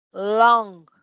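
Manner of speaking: "Long" loud with a back ng at the end; final ng demo. Shown as a demonstration. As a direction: model "Long", hold the ng. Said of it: "el sonido de esa N al final".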